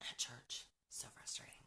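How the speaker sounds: a hushed, unvoiced aside; whispered aside. Real, not acted. Mom whispering her frustration about church kids. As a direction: whisper it like a confidence.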